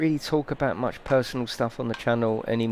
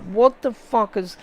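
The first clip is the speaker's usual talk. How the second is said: high-pitched mock outrage; retold angry shock; 11 semitones higher than usual. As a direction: replay the moment you saw the damage. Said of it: "I was going mental".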